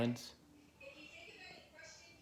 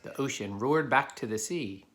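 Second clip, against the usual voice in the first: put-on steady storybook narration for kids; reading a story aloud; 5 dB louder than usual. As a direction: read it to the class like a picture book. Reads a picture book to children.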